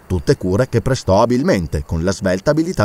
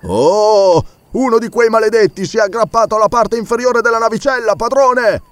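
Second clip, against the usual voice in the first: alarmed servant; 10 semitones higher than usual. Acted. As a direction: warn the master in a panic. (Joe avverte il padrone, allarmato)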